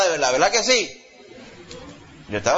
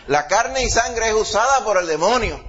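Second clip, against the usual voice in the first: high, raised preaching voice; fervent preaching; 7 semitones higher than usual. Acted. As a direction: drive the point home to the church. Preaching at full force. (predicación ardiente y en voz alta)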